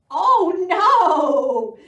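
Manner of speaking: high, loud, bleating wail; frightened sheep. Acted. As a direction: cry out like a scared sheep. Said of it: "bleated the sheep".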